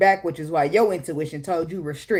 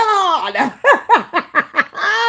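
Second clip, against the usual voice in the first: high, loud burst of laughter; loud cackling; 12 semitones higher, 7 dB louder than usual. Real, not acted. Cracking up at the guard-up reply, loud laughter.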